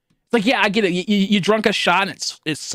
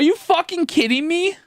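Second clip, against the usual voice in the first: incredulous retort; 12 semitones higher than usual. Retold or imitated. Retold argument with friends.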